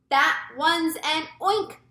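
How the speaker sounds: high, loud, slow cry; storybook scream, drawn out; mild scream. A narrator's reading. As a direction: cry out in alarm at a monster. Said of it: "screamed the head of the fire department".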